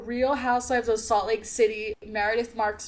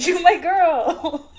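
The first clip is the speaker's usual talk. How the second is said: put-on slow, laughing lover-boy line; mock Darcy; 6 semitones higher, 8 dB louder, much slower than usual. S1 playing Darcy to Lizzie, slow and laughing. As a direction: stretch it out teasing and smug.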